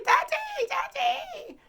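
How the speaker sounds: put-on very high, loud child's scream. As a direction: scream for your dad as a scared four-year-old. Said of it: "So I start screaming".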